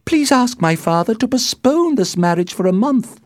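high, loud desperate plea; distressed daughter pleading loudly; crying out. A narrator's reading. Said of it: "she cried".

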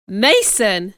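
loud, startled cry of the name; shocked exclamation. A narrator's reading. Rochester hears of his visitor, shocked exclamation. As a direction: exclaim it in alarm. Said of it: "he exclaimed, his face becoming pale".

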